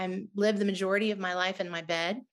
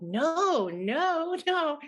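higher, rising mock cry of no; a retold plea, pitched up but not yelled; inner scream; 8 semitones higher, much slower than usual. Her inner protest at the doctor's news, pleading cry. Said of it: "everything in me was just screaming out like".

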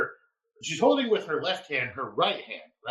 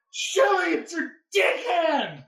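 mock urgent shout; 13 semitones higher, 5 dB louder, slower than usual. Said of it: "And I'm like".

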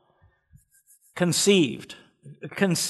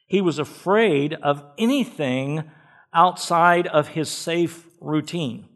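ordinary delivery

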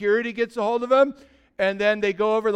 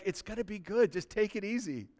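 gentle reassurance; 9 dB quieter than usual. Retold or imitated. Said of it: "he's like".

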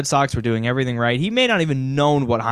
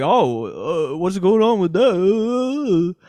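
higher, slow, puzzled imitation of the owner; mock bewildered, drawing the question out; imitating a confused boss caught off guard; flustered; 7 semitones higher, 4 dB louder, much slower than usual. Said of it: "he gets all, like, flustered".

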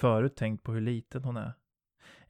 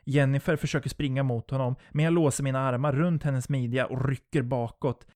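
usual speaking voice

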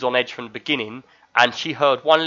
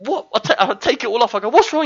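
high-pitched, alarmed "what's wrong"; acted startled question; 10 semitones higher, 5 dB louder than usual. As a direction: re-enact a dad jolted by a scream. Acts out startled reply to screaming son.

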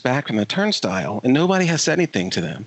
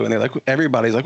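ordinary talk, nothing marked

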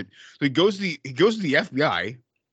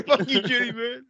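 laughing echo; 9 semitones higher than usual. Real, not acted. Echoing, laughing.

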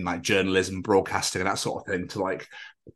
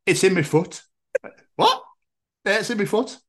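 mock friend with a new diagnosis; 7 semitones higher than usual. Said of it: "And he'd be like".

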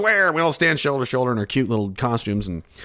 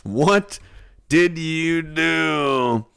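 drawn-out scolding; 5 semitones higher, much slower than usual. Acted.

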